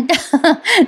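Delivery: short amused laugh; a real, light laugh breaking out. Real, not acted. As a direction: burst of genuine giggling. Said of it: "मला फार हसू आला".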